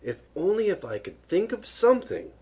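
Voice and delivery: high, wishful musing to oneself; talking to himself. A narrator's reading. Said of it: "Pooh was saying to himself".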